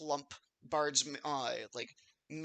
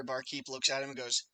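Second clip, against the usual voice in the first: ordinary delivery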